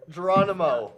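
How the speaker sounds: loud laughing call; laughing shout. Real, not acted. Joking about Jerry's name.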